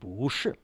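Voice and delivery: BU going up, then SHI coming down; rising tone change. Shown as a demonstration. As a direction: lift BU into SHI. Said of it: "bu going up instead of down".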